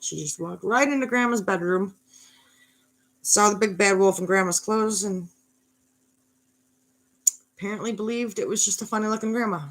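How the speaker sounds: usual speaking voice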